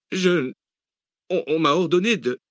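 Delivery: high, panicked stammering; frightened stammer. Acted. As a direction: stammer out in fear. Boy stammers under threat.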